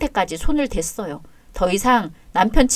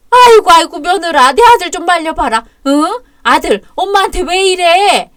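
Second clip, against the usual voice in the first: hammed-up wailing; 6 semitones higher, 6 dB louder than usual. Mother-in-law wailing for help.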